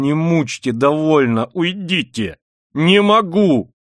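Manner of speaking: high, loud exasperated outburst; irritated. A narrator's reading. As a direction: push them away, rising to a cry. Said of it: "раздражительно повторял он". (раздражённо, почти криком)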